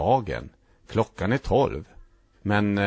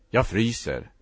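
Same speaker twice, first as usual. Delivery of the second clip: ordinary delivery, nothing marked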